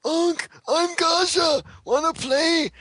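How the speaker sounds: put-on very high, slow, sing-song kid voice; childish monster voice. Gamel inviting Ankh to play, very high childlike voice. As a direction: play a creepy little child asking to play.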